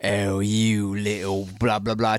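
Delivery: slow, put-on posh British accent; slowly mock a posh Brit in a British accent; British accent impression. Host showing one of his British accents, slow and posh British. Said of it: "switched up between a".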